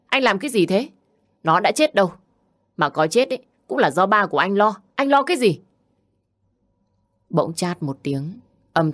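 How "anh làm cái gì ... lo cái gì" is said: shrill scolding. Acted. Thùy Chi scolds Đức Tiến.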